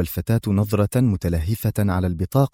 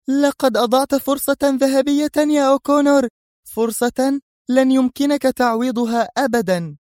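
high, loud raging woman; furious yell; 3 dB louder than usual. Acted. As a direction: scream it in his face. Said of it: "صرخت سونيا بهذه العبارة في غضب وثورة".

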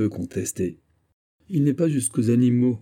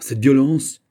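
louder, higher indignant protest; outraged cry; 5 semitones higher, 8 dB louder, slower than usual. Acted. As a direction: protest at the violence. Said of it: "s'écria Colbert". (Colbert outré, plus fort et aigu)

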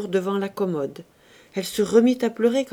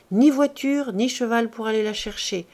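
ordinary delivery, nothing marked

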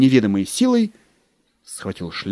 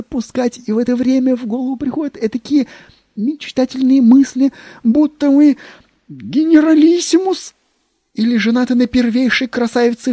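high, loud, rising rapture; rapturous; 12 semitones higher, 5 dB louder than usual. Acted. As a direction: dream aloud ever more excited. Secretary dreamily escalating.

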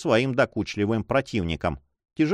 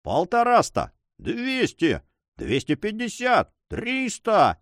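shouted bids; 8 semitones higher, slower than usual. A narrator's reading. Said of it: "закричали в то же время несколько авантюристов".

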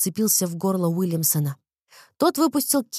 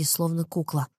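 usual speaking voice

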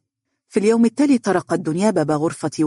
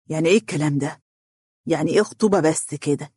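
level, measured query; questioning; faster than usual. A narrator's reading. Father questioning his daughter about the engagement, puzzled but controlled. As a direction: ask for an explanation without raising the voice. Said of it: "نظر إليها والدها بتساؤل".

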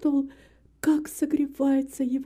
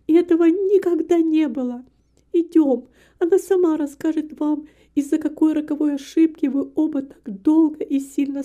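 high, loud, emphatic protest; urgent insistence; 4 semitones higher, 6 dB louder than usual. A narrator's reading. Daughter corrects her mistaken father, urgently insisting. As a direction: set him straight with earnest urgency.

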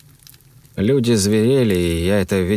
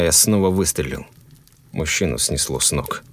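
ordinary delivery, nothing marked

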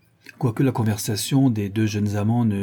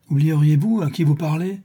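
higher, louder, affronted rebuke; haughty; 7 semitones higher, 5 dB louder than usual. A narrator's reading. Count reminding young man of his rank, haughty. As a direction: pull rank with an indignant question. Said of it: "dit le comte avec hauteur".

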